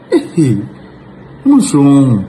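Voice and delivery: high, loud exclamation; dismayed outcry. Real, not acted. Commenter appalled at the Ghana girls, loudly exclaiming. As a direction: cry out in exasperation.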